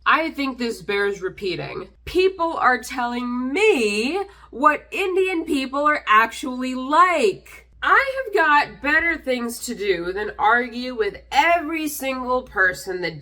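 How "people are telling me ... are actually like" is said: each word slow and heavy, a little louder; slow emphatic outrage. Real, not acted. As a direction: spell it out slowly so it sinks in. Repeating a point, outraged.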